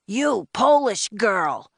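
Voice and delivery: high, drawn-out bossy shout; loud agent's reprimand. A narrator's reading. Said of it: "his voice even louder than his jacket".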